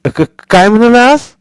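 straining to catch the words; hard-of-hearing grandmother. Acted. Deaf grandmother asking Sonya what he said, loud.

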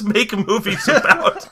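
giggling through the words; laughing. Retold or imitated. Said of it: "it just says".

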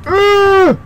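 sudden high startled scream; real scream of fright. Real, not acted. Player startled by a monster, screams. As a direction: yelp at the jump scare. Jump scare.

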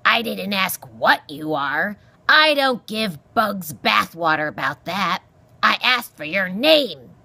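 loud, irascible porcupine voice; grumpy old porcupine. Acted. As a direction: scold as a crotchety old animal.

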